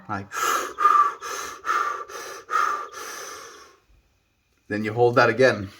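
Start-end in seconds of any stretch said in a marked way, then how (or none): 0.3-3.5 four sharp breaths in; breathing demo. Shown as a demonstration. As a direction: demonstrate breathing in through four audible breaths. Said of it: "four breaths in".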